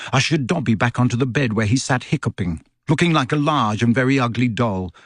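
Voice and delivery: ordinary delivery, nothing marked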